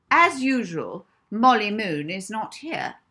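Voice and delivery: loud, high, sharp scolding teacher; loud stern schoolmistress calling out. Acted. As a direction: scold the late girl in front of everyone.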